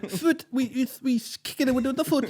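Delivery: high put-on voice mocking football fans; mock European voice. Teasing the American friend in a high mock European voice. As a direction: squeak it out like a caricature of a European.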